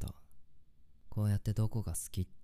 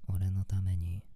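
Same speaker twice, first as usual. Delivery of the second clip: normal talking voice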